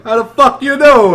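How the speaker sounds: mock a brash New Jersey accent. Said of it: "That's just how we talk".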